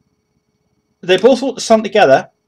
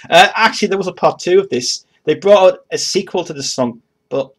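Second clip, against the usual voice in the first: ordinary talk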